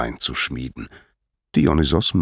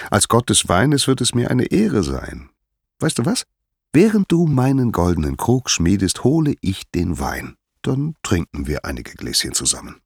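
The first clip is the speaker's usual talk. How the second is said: lightly voiced, persuasive Dionysos; coaxing wine god; 6 semitones higher than usual. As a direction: play a sociable god offering wine. Dionysos coaxes Hephaistos with wine.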